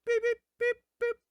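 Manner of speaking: high beeping bleeps; spoken morse code. Pretend. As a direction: beep it like a censor tone. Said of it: "sound like fucking Morse code".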